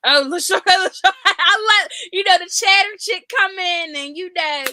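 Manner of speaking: cracking up as she's called on; laughing protest. Real, not acted.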